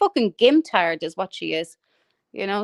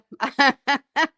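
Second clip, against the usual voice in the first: higher run of laughter; amused cackle; 4 semitones higher than usual. Real, not acted. Ranter laughing at a jibe about her face, amused cackle. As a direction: crack up at your own insult. Laughing at their own insult.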